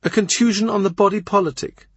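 a sly lady scoring a point; lightly voiced teasing wit; arch courtier. Rochford's witty retort.

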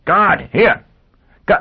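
high, loud shout for the guard; sudden high alarm call. Acted. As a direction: yell for help across the hall. Calling for a guard.

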